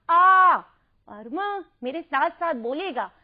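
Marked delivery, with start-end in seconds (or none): 0.1-0.6 loud, high sung-out vowel; loud model sound. Shown as a demonstration. Teacher showing the vowel sign, calls आ out loud. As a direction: call out the vowel आ long and bright. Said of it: "आ की मात्रा तो हो गया".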